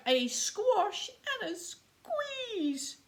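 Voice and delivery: put-on high, wailing old lady voice; distressed old lady. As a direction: cry it out like a frantic old lady. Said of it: "The little old lady cried".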